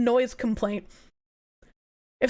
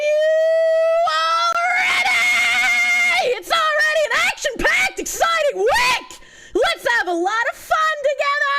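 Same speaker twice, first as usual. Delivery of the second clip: wrestling announcer; 14 semitones higher, 5 dB louder than usual. Pretend. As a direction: belt it out like a ring announcer. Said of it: "How do I sound like a wrestling announcer?".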